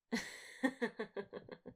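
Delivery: soft amused laugh at his shocked face; teasing laugh. Acted. Slayer laughing at the captive vampire's startled face, teasing. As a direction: laugh at him, enjoying the joke.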